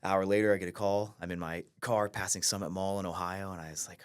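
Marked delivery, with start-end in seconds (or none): none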